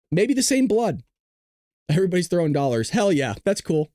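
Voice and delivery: ordinary delivery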